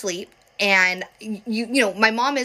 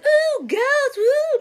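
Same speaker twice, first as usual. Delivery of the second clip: high, mock-spooky ghost voice, louder; playfully haunt the room like a cartoon ghost; spooky ghost tease; 4 dB louder, much slower than usual. The kids teasing mom about ghosts, mock-spooky high voice.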